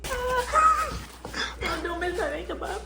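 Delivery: high squeals, laughing and sputtering; splashed in the face, squealing; sprayed with water. Real, not acted. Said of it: "It's in my mouth".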